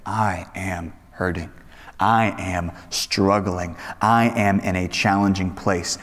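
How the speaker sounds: low, soft, slow admission of pain; subdued, heavy-hearted and halting; emotionally charged confession. Acted. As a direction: confess it slowly and quietly. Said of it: "powerful emotional charge to the words".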